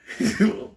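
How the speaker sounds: short high, loud laugh. Real, not acted. Storyteller cracks up at his own tale, short laugh. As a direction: burst out laughing briefly.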